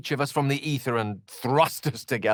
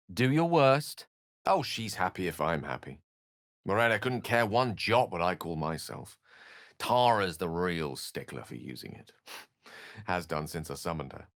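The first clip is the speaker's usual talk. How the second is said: ordinary talk, nothing marked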